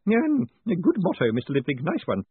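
high, nervous laughing Spools; a hint of an unsure grin; uncertain grin. A narrator's reading. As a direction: chuckle along without quite getting it. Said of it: "grinning uncertainly".

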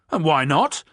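loud, high angry retort; furious. Acted. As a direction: snap back furiously. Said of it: "answered the second furiously".

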